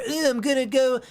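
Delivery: lightly voiced snooty city hipster. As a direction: sneer it like a trendy downtown hipster. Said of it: "not the hipster libtard".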